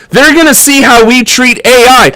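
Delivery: ordinary delivery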